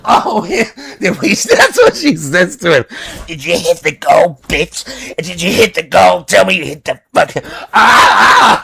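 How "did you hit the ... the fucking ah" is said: mock yelling demand. Kat voiced demanding.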